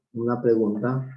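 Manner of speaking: usual speaking voice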